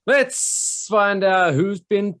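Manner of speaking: slow, emphatic segment intro; show announcer. Acted. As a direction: announce the segment grandly and slowly.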